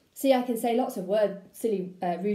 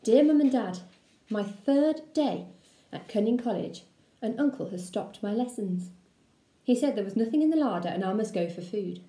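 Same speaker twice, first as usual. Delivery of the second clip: ordinary delivery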